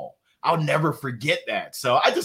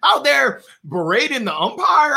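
ordinary talk

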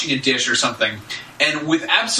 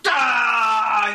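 mock upset cry; 7 semitones higher than usual. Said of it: "gets all upset".

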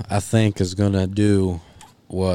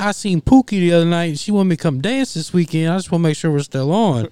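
high, louder mock caller; mimicking a caller; 9 semitones higher, 4 dB louder than usual. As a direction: pitch it up like the prank caller. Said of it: "He, he, he said".

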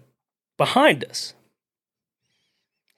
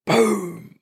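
gunshot imitation; 8 semitones higher than usual. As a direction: mouth gunshot boom, higher pitch.

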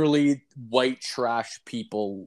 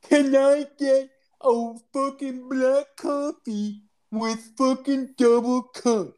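very high, slow, laboured mock impairment; 11 semitones higher, slower than usual. Customer re-orders at the drive-thru, mocking impaired speech. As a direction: drag each word out in a high put-on voice. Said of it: "talk like a fucking handicap".

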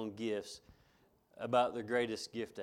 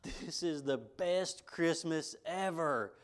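put-on slow, drawn-out child's delight, pitched up; mild imitation of a kid yelling with joy; excited kid's shout; 6 semitones higher, much slower than usual. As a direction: mimic a thrilled little boy. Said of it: "and he yelled".

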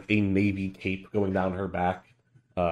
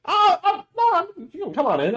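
much higher, louder flustered host; 7 dB louder than usual. Acted. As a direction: squeak it out, caught off guard. Anne flustered answering.

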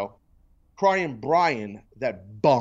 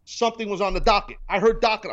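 ordinary talk, nothing marked

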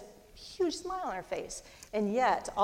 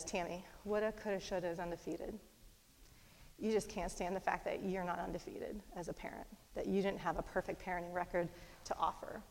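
much softer, steady and gentle; calm pastoral correction; 9 dB quieter than usual. Retold or imitated. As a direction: quietly talk sense into your wife. Husband calmly preaching to wife.

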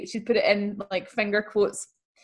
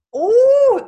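loud surprised oh; 11 dB louder than usual. Real, not acted. Reacting to the letter's end.

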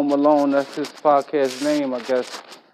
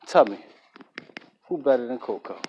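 ordinary talk

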